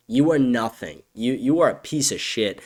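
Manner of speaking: normal talking voice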